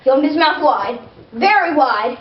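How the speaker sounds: usual speaking voice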